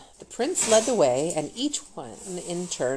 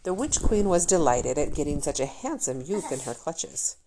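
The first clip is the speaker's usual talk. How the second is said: usual speaking voice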